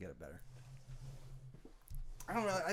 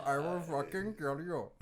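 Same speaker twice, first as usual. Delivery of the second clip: put-on slow threat in Indian accent; Indian Don; 5 dB quieter, slower than usual. Indian Godfather threatening someone who came to him, slow Indian accent. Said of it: "like, an Indian Don".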